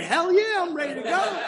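high-pitched, eager retold yes; hammed-up gung-ho enthusiasm; excited. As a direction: leap at the invitation, voice way up. Eagerly agrees to go back.

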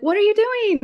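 mock shocked kids; shocked friends. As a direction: demand it in disbelief. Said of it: "they're like".

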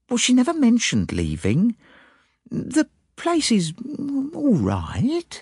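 high maid's voice, slowing with pauses; guarded servant girl, halting over her words; hesitant maid. A narrator's reading. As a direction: hold back your real opinion, reluctantly. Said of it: "The girl hesitated".

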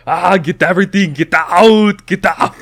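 high, loud panicky shouting; panicked yelling. Retold or imitated. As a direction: yell it like a man in a frantic panic. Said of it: "he's panicking and running around".

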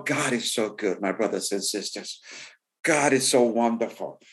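ordinary talk

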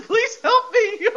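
very high, slow, hammed-up pleading moan; crying and moaning. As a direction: drag out a mock sob for help. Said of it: "sounded like Paul Bearer with all the crying and moaning".

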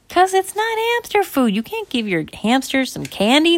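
high, emphatic parental telling-off; scolding parent. Acted. Parents explaining refusal to daughter, scolding. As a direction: lay down the rule from downstairs.

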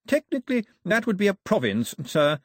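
high-pitched, solemn correction; earnest, proper courtier; grave. A narrator's reading.

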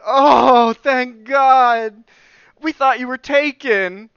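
high, loud, gushing mock relief; huge relief. Neighbor hearing the missing boy is safe, huge relief. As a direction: let the worry burst out loudly.

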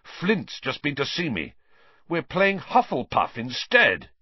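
very angry. A narrator's reading. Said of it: "looking very angry".

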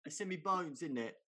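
loud, high hammed-up British accent; British accent reply. Answering the British lady in a loud hammed-up British accent. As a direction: belt it out in a comic British accent. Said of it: "I did a British one".

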